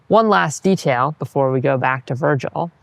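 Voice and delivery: ordinary talk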